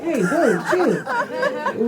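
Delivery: chill jumps way up, laughing; startled calm-down; mock surprised whoa. To her ex-boyfriend, a squeaky startled chill. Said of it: "I was like".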